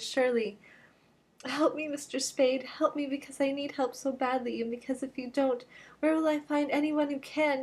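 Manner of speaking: kneeling before Spade, begging desperately; desperate femme fatale. Acted. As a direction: beg him for help, all throb and urgency. Brigid's long pleading speech.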